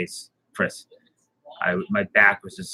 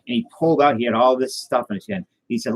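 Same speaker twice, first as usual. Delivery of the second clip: normal talking voice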